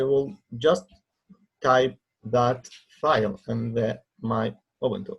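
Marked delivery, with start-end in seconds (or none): none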